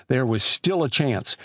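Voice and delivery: ordinary delivery, nothing marked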